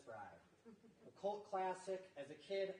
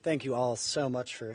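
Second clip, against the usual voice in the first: loud, lower classroom call to a crowd; teacher voice; 3 semitones lower, 19 dB louder than usual. Real, not acted. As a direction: project loudly to get the room's attention. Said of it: "Got my teacher voice on right now".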